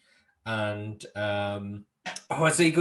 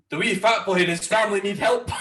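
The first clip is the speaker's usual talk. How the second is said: loud, high mock Scots Willie; 7 semitones higher, 6 dB louder than usual. Willie watching the portable TV, loud and high. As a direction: bellow it like a worried groundskeeper. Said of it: "He's like".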